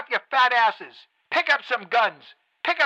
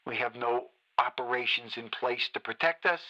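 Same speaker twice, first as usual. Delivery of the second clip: much lower and softer, bitter and drained; quiet seething despair; 9 semitones lower, 6 dB quieter than usual. Acted. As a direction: let the anger collapse into a low murmur. Host sinks into bitter despair.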